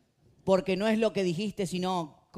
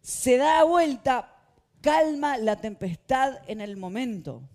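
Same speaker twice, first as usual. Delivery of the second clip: dramatic slow; 6 semitones higher, 7 dB louder, slower than usual. Acted. Dramatic storm calming.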